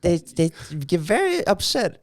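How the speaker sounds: hammed-up Chinese accent impression; mock Chinese accent. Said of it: "We're not bad at Chinese accents".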